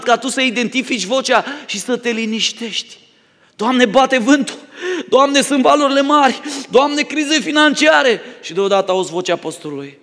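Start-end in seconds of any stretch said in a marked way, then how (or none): 3.6-8.2 higher, slow mock panic with a laugh; a scared believer complaining to God about storms, in mock panic; panicked prayer. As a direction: cry out to God, hammed-up alarm. Frightened believer crying to God.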